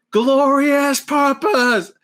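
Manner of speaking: high, loud, drawn-out proclamation; imitating a grand, defiant declaration; old Loki's grand cry. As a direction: belt it out slowly like a theatrical battle cry. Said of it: "And his, like, when he goes to".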